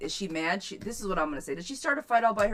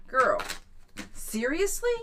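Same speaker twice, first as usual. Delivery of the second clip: incredulous; 4 semitones higher, slower than usual. Real, not acted. Ribbing a viewer whose bathroom still isn't done, incredulous. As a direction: act as if you can't believe it.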